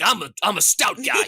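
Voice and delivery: high comic character voice; lightly hammed-up boast, pitched high; stout-guy player. Made-up stout player introducing himself, high comic voice. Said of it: "and he's like".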